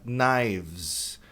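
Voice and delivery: "knives" as naivz, no K; "knives" begins on n, ends in a z sound; silent K. Shown as a demonstration. Teacher models "knives" without the K. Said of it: "A pronúncia é knife".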